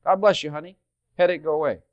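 a hint of a slick faith healer brushing off a headache; mock faith healer. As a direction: toss off a blessing like a quick-fix healer. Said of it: "He should have said".

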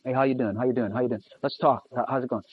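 Jesus quietly greeting each of his sheep; gentle greeting. Acted. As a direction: greet each one softly like a shepherd.

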